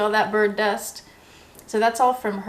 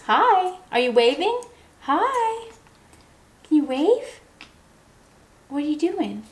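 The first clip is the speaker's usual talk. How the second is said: much higher, sing-song pet voice for the parrot; pet talk; 7 semitones higher than usual. Real, not acted. Cooing at her parrot.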